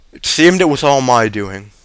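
ordinary delivery, nothing marked

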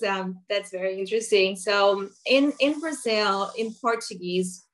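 usual speaking voice

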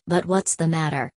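usual speaking voice

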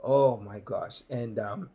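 usual speaking voice

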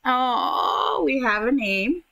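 ordinary talk, nothing marked

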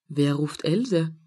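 ordinary delivery, nothing marked

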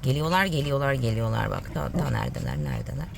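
soft low excited murmur; hushed excitement. Real, not acted. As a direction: hush to the dog, here they come. Whispery excitement to dog.

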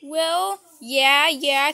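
ordinary talk

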